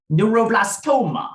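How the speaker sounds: normal talking voice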